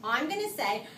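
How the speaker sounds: ordinary talk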